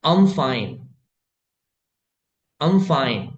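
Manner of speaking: "I'm fine" said slowly with "I'm" as one syllable; slow repeat-after-me. Shown as a demonstration. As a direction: model "I'm" run together for students. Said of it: "दोहराने का मेरे साथ".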